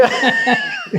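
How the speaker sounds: shrieking laugh; high burst of laughter. Real, not acted. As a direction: break into a squealing laugh. Laughs at own story.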